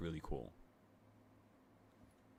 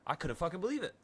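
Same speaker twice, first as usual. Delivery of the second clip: higher, rising in disbelief; 10 semitones higher, faster than usual. Retold or imitated. Telling the free ticket story, higher and disbelieving. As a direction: lift it up, still can't believe it.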